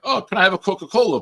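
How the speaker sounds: high, fast eager ordering voice; imitating Charles. Dad mimics son ordering another soda, high and fast.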